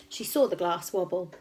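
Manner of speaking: plain reading voice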